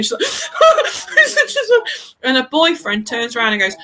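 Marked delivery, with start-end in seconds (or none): 0.3-2.0 high-pitched hammed-up sobbing; mock blubbering. Imitating the girl crying behind them in the cinema, mock blubbering. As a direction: imitate someone proper blubbering. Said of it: "this girl behind us is proper blubbering".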